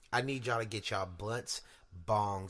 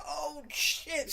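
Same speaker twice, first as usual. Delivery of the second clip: surprised husband. Retold or imitated.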